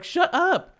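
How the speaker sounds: high, loud indignant rant; mock angry outburst. The offended owner ranting at the clerk in her head, high and loud. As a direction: vent like an offended dog owner. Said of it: "I was so mad".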